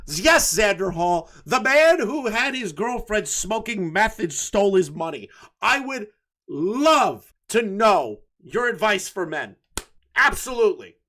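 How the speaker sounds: loud, emphatic mock eagerness; hammed-up loud approval; sarcastic enthusiasm. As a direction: cheer on the advice with heavy irony.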